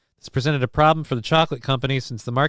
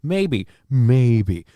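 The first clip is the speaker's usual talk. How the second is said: mock dude; 4 dB louder, much slower than usual. Streamer mocking the weed advice, slow bro voice. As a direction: stretch the maybes like a lazy advice-giver. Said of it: "And it's like".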